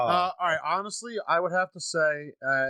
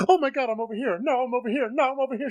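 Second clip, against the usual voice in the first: mock panicked victim; 9 semitones higher, faster than usual.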